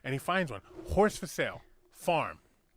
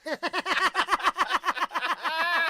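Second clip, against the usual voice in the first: laughing; 13 semitones higher, 3 dB louder than usual. Real, not acted. Teller laughs at own punchline.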